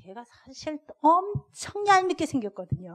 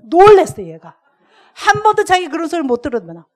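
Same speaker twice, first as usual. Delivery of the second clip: excited burst; 4 semitones higher, 13 dB louder than usual. Real, not acted. Recalling child's shock.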